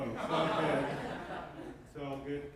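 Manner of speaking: ordinary talk, nothing marked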